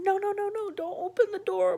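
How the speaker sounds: high, loud frantic no no no; retold panicked scream. She relives the break-in, screaming loud. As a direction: yell at the man to stop. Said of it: "I kept screaming".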